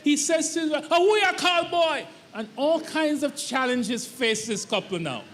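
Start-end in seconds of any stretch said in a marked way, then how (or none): 0.9-2.1 higher, mildly indignant protest; a touch of offended husband; upset husband. Retold or imitated. Husband objecting to being called boy, mild indignation. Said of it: "he's quite upset".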